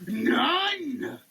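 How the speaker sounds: emphatic none. Acted. Label list, punchline.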